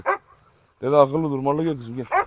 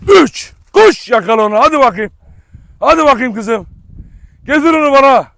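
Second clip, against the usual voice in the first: excited shout; 9 semitones higher, 10 dB louder than usual. Real, not acted. Count and urge dog to fetch.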